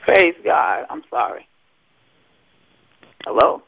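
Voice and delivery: normal talking voice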